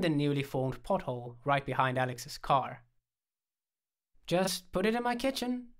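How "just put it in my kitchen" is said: high, rising directive; high-pitched offhand order; casual instruction. A narrator's reading. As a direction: instruct lightly with pitch lifted. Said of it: "I instructed".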